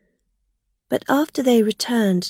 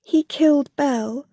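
tearful; 5 semitones higher than usual. A narrator's reading. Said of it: "began to cry".

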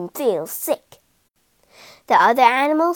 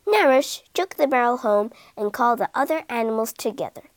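usual speaking voice

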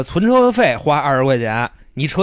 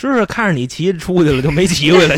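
normal talking voice

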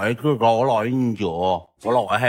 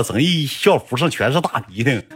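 ordinary talk

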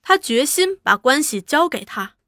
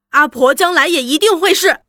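louder, higher, stirred up; excited; 4 semitones higher, 7 dB louder than usual. A narrator's reading. Young woman moved, answering the old mother excitedly. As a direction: say it with rising fervour. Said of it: "庆娘非常激动".

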